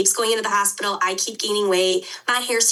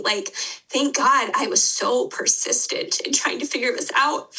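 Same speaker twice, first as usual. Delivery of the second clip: real tearful, higher voice; crying; 5 semitones higher than usual. Real, not acted. Podcast guest apologizing for crying as her story comes out, crying. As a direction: talk through tears, pitch rising. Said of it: "usually don't cry on podcasts".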